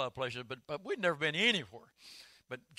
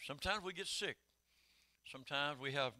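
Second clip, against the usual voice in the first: ordinary talk, nothing marked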